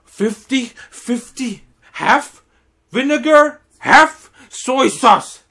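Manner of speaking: high, loud, slow spelled-out insistence. Retold or imitated. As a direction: hammer the ratio home like he's talking to a child. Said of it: "And he's like".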